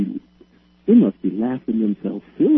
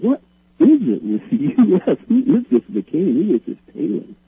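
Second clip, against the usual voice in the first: higher-pitched imitation of baffled questioners; mock bafflement; 8 semitones higher than usual. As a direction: mimic people asking in exaggerated disbelief. Retells baffled Swedes asking about candidates.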